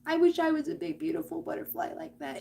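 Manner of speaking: acted small, wistful longing; wistful self-doubter. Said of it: "And you're sitting there like".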